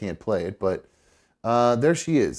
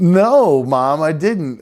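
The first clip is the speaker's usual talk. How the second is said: louder mock protest to mom; retold reply; 4 semitones higher, 7 dB louder, much slower than usual. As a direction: bark the no back at his mother. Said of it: "And I was like".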